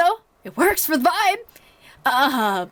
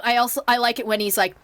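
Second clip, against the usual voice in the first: normal talking voice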